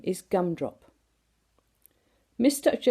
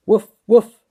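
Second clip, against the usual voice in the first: very loud barking dog voice; 3 semitones higher, 12 dB louder than usual. A narrator's reading. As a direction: bark it out like the dog. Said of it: "went Horace".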